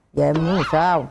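low, laughing mock of a husband pressing his voice down; low pressed voice. Wife imitates irritated husband pressing voice down, low mock growl. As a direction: lightly imitate him lowering his voice. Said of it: "ổng sẽ gằn cái giọng xuống".

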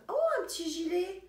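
high, loud "Oh, un petit gilet!"; thrilled at the little cardigan; delighted find. Real, not acted. Unpacking the gift box, a thrilled high cry.

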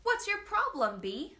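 put-on boy loses his temper at a silent bee; frustrated yell. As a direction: throw up your hands and let it rip. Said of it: "threw his hands in the air and yelled".

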